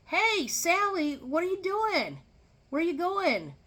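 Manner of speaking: high, called-out greeting from across the store; man calling out. Retold or imitated. Man calling Sally across the store, bright call-out. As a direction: call out to her cheerily.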